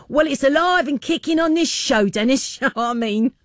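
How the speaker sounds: high, playful Cockney; Cockney accent. Acted. Radio host jokes that Cockney is alive and kicking, Cockney accent. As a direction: put on a cheery East London Cockney. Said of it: "I have to fight it".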